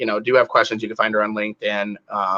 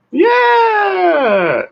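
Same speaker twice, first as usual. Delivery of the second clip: excited yeah; 5 dB louder, much slower than usual. Real, not acted. Host cheering a hometown answer.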